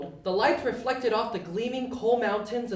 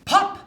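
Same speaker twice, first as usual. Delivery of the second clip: louder, high pop; pop sound; 11 semitones higher, 8 dB louder than usual. A narrator's reading. Narrator making the elf appear with a pop. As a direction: pop out of nowhere.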